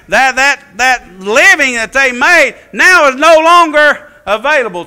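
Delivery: loud, high, driving sermon voice; preaching at full force. Acted. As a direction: preach it hard to the congregation. Preaching build.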